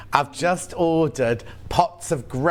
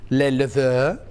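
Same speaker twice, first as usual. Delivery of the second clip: put-on French with Yorkshire accent; 4 semitones lower, slower than usual. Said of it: "speaks French with a Yorkshire accent".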